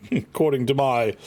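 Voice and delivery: ordinary talk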